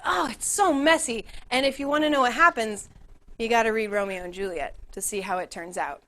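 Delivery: normal talking voice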